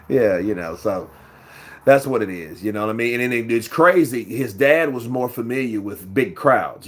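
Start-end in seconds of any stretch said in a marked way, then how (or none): none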